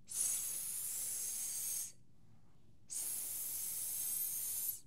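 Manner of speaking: long whispered sss; snake hiss. Shown as a demonstration. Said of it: "¿Puedes hacerlo conmigo?".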